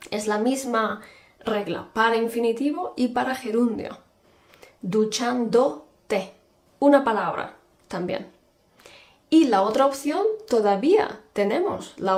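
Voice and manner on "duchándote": very slow, careful Duchándote; slow classroom demo; slow model word. Shown as a demonstration. Teacher models one-word form slowly.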